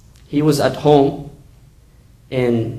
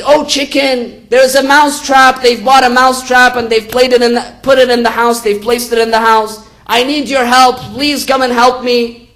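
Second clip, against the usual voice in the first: high, urgent acted plea, louder; pleading yell; 8 semitones higher, 4 dB louder than usual. Mouse begs the chicken for help, pleading yell. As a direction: call out and beg for help in a raised, high voice. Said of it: "He begins to yell and plead".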